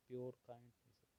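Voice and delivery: usual speaking voice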